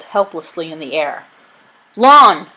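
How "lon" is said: high, loud hail by name; calling out. A narrator's reading. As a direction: call sharply to a shipmate. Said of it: "called Ben".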